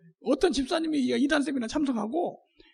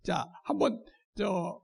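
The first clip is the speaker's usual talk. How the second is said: ordinary talk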